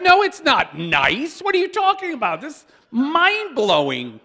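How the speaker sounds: high, loud, incredulous protest; animated mock outrage; excited protest. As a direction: push back in amazement. Retold reply to a bland listener.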